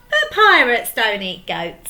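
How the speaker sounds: laughing. Acted. Laugh heard.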